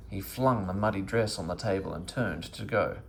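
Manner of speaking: plain reading voice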